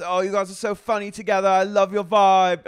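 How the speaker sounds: high, loud gushing fan; a loud, high gushing coo; gushing impression. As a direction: gush over the couple, loud and high. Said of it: "he goes".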